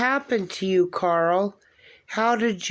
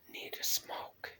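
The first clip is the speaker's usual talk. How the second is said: breathy whispered aside; whispering; 19 dB quieter than usual. Real, not acted. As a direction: whisper it under your breath. Shaken after spirit box answer.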